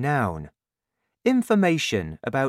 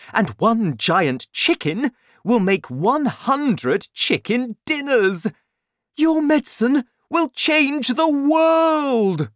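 very excited father; 13 semitones higher, 7 dB louder than usual. A narrator's reading. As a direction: proclaim a world-changing vision. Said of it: "He was very excited now".

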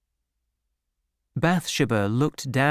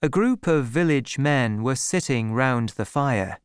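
ordinary delivery, nothing marked

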